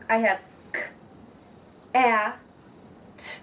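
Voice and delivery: k, a, t each sound said on its own with a gap between; sounds split apart. Shown as a demonstration. Teacher sounding out k, a, t for a student, one sound at a time. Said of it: "So I have three separate sounds".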